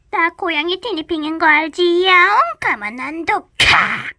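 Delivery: high, menacing with a cat hiss; cat threat. Acted. Cat warns big sister, hissing.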